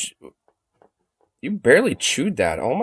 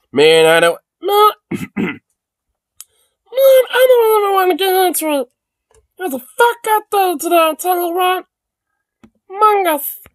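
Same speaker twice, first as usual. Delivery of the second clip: high put-on voice of a worn-out guy at the bar; high, put-on barfly voice; tired bar regular. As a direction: play a weary regular venting about his day.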